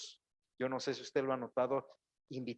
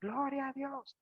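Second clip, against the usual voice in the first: high, drawn-out imitated cry of praise; shout of praise; 11 semitones higher, 3 dB quieter than usual. Preacher imitating the congregation's proper reply, as a high drawn-out cry. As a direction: answer back like the congregation. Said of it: "Y ustedes gritan".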